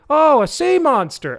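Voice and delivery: high, loud put-on exclamation; mock elderly amazement. Elderly couple spotting the sea monster, loudly amazed. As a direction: announce it like an astonished old theatergoer. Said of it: "they'd be like".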